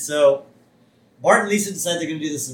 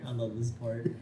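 ordinary talk

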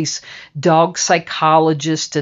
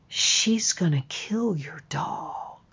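slow, breath-only whisper; whispered warning; 9 dB quieter than usual. Retold or imitated. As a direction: whisper it like a voice right in the ear. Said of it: "whispered in my ear".

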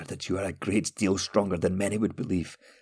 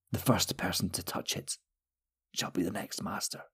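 whispered final secret; a dying wizard whispers who becomes master; 12 dB quieter than usual. A narrator's reading. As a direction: breathe the last words out.

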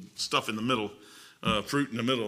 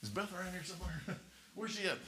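much softer, inner voice; little voice in head; 3 semitones higher, 17 dB quieter than usual. Retold or imitated. Preacher voicing a thought in his head, soft. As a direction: hush it like a thought. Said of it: "that little voice in your head".

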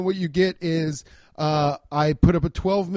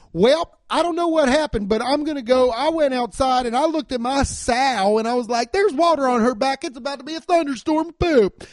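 raised, hammed-up imitation of a rural twang; mock country drawl; 8 semitones higher than usual. To listeners, mocking a high school friend's fake country voice. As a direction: lampoon his fake farmer accent, pitched up. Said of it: "he now talks like this".

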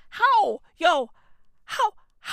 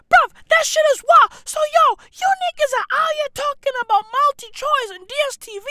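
exasperated disbelief. Real, not acted. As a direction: yelp it out in outraged disbelief. Ranting in disbelief at fans.

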